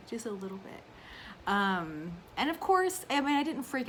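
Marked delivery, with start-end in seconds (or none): 0.1-0.8 imitating calm mom. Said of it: "That's how she did".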